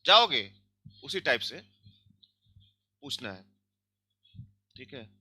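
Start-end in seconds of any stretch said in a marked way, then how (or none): 0.0-0.5 जाओगे asked high and loud with a fall; Hindi question tune. Shown as a demonstration. Teacher comparing the Russian question to a Hindi one. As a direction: ask जाओगे with a sharp peak. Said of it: "हम लोग भी ऐसे हिंदी में पूछते हैं ना".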